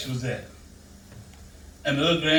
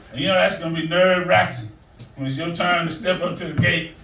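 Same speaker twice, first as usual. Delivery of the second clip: normal talking voice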